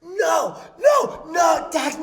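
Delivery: high, loud alarmed no; retold yelling. Mom yelling at Dax to stop, high and loud. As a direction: yell to stop the child. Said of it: "Here's what I heard yesterday".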